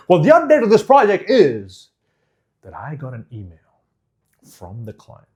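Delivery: hammed-up stage delivery of a work update; overdone variation. Shown as a demonstration. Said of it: "don't say".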